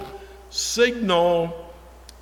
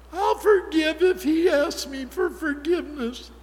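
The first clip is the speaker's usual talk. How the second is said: sing-song, much higher mock of a self-righteous whine; mock self-pity; 10 semitones higher than usual. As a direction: mimic the self-pitying believer, pitched way up. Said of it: "Self-pity".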